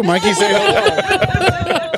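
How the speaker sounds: high, slow teasing prompt; playful sing-song call. Acted. Calling on Mikey playfully.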